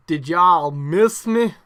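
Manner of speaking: put-on loud, drawn-out Southern US accent; hammed-up Southern wrestler shouting to the crowd; terrible Southern drawl shout. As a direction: holler it slowly in a badly faked Southern drawl. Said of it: "and he shouts".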